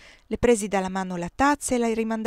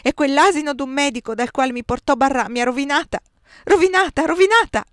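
loud cry building to a much higher shriek; rising scream of ruin; sudden cry; 7 semitones higher, 11 dB louder than usual. A narrator's reading. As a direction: Josephine cursing the doctor, crying out louder and louder. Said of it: "improvvisamente gridò".

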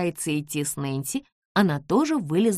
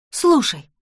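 louder, sharp alarmed call; tense shout; 8 semitones higher, 7 dB louder than usual. Acted. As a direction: cry out in tension. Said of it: "напряжённо выкрикнула Джордж".

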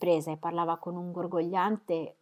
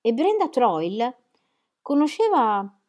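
playful; 5 semitones higher, 8 dB louder than usual. A narrator's reading. Mary listing lovers, rising animatedly.

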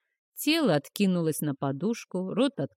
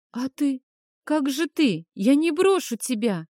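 high, louder desperate plea; desperate pleading; 7 semitones higher, 4 dB louder than usual. A narrator's reading. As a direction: beg him not to leave. Boy pleads in distress.

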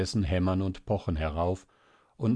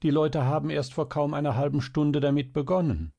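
higher, urgent objection; exclaiming; 7 semitones higher than usual. A narrator's reading. Mother contradicting the child about the door, exclaiming. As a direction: exclaim it, pitch lifted. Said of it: "rief die Mutter".

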